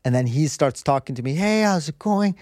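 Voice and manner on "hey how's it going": lightly voiced cheerful monk, higher and slow; friendly monk. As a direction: greet like a beaming monk, stretched out. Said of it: "this is the monk".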